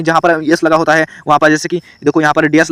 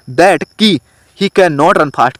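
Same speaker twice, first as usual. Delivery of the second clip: slow dictation read; much slower than usual. Shown as a demonstration. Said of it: "teacher reads corrected sentence".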